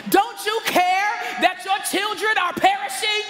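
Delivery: wife yelling. Retold or imitated. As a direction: call down like a frantic mother. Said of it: "the first lady yells down the stairs".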